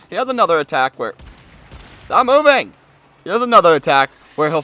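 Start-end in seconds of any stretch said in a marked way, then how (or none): 2.0-2.7 high, loud shout at target; frustrated yell. Real, not acted. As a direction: yell at the moving enemy. Yelling at moving target.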